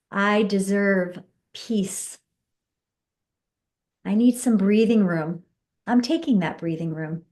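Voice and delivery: slow, settled voice ending in a sigh; slow calm affirmations. Shown as a demonstration. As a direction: let the peace land as you speak. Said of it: "you say what you want instead".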